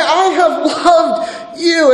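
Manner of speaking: high, slow, emphatic declaration; God proclaiming love insistently; God declaring love. Acted. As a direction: stretch each word out, pitched high. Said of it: "as God starts out here".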